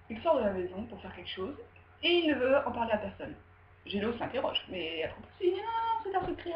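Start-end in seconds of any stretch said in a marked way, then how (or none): 5.5-6.5 high, light brush-off; acted evasive denial. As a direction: wave the question away in a high voice. Voices father brushing off son's question.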